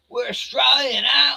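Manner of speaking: loud, high proud cheer; patriotic holler. Pretend. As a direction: shout it with mock national pride.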